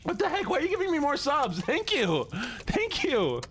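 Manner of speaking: squeaky disbelieving gratitude; high surprised thanks. Real, not acted. Surprised by more subs.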